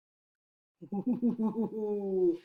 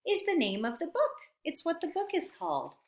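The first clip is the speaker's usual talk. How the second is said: quick teacher explanation; 8 semitones higher, 3 dB quieter, much faster than usual. Shown as a demonstration. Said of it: "I just wanted to make it very clear".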